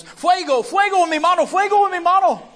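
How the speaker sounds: high, loud mock yell in Spanish; astonished yelling. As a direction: yell it like your hand is on fire. Man cries out as hand heals.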